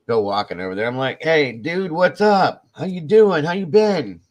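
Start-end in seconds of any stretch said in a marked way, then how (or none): 1.2-4.2 loud, high, exaggeratedly friendly hail; overeager greeting. Storyteller greets the brother at Walmart, overeager. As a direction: imitate calling out a big hello. Acted hearty greeting across the aisle.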